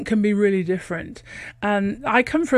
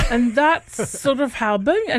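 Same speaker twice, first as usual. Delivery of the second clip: ordinary talk, nothing marked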